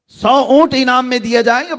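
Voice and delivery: اونٹ lifted high with a rising tune; emphatic repeat. A narrator's reading. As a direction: raise the voice on سو اونٹ as if announcing the prize.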